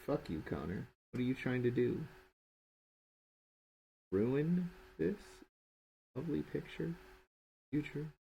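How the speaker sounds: soft, quiet grumpy voice for the picture; mock drawing-program voice. Artist voicing his own drawing scolding him, quiet mock grumble. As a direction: let the drawing scold you, half-mumbled. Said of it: "without it going".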